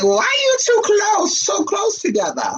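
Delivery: high, slow put-on woman's voice; suspicious drawn-out question, pitched high; high mock jealous girlfriend. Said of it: "she gonna be like".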